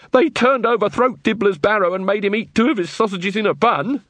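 high, outraged report; indignant reporter. Acted. Answering Carrot on the breakdown of law, indignant.

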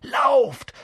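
high, loud cry to run; urgent shout. A narrator's reading. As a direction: shout it out high to get them moving. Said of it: "rief Harry".

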